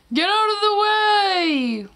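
horn shout. Shown as a demonstration. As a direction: bellow it like a tractor horn. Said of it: "The horn is saying".

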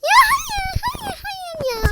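high, loud wail of no fading to a cry; despairing shout. Real, not acted. Gamer wailing as the other team scores. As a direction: howl then whimper. Goal conceded.

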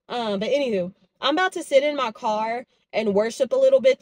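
ordinary talk, nothing marked

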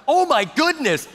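high, drawn-out astonishment; mock shock. Pastor hearing the swearing, mock shock. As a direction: pitch it way up, slow and aghast.

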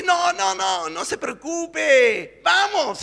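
very high, loud shout, the middle stretched slowly; carefree shout. Acted. María shouting back at Juan as she runs to the beach, high carefree shout. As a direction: wave him off at full voice, running to the sea. María brushes him off running.

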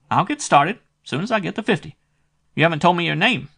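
a small-time dick squeaking out his price; shrill private eye. A narrator's reading. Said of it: "This dick had a shrill voice".

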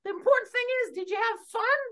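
very high cheerful puppet voice; Char Char encouraging. Acted. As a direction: cheer up the friend in a high squeak. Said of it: "Hey, Char Char".

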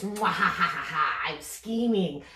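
low evil villain laugh; scheming villain. Shown as a demonstration. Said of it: "let's scheme with our fingers".

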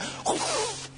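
a rushing whoosh of breath; imitated wind gust. Shown as a demonstration. Preacher showing how the wind hit his church, imitated whoosh. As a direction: blow out like a sudden wind. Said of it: "just a wind came".

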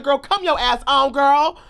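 high, loud sassy summons; urging a friend along. Retold or imitated. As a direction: call a friend over impatiently. Retold calling his friend over.

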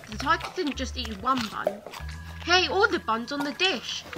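plain reading voice

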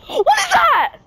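startled shriek; frightened gasp. Real, not acted. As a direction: gasp then high scared cry. Sudden fright at mob.

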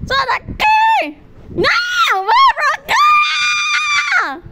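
shrill terrified shouts; panicked screaming. Real, not acted. Screaming at creature.

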